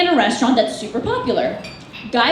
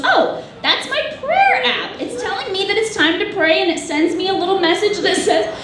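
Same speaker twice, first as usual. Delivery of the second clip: mom's voice; 4 semitones higher than usual. Acted. Mom explaining her prayer app, innocent. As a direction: play mom brightly explaining, pitched well up.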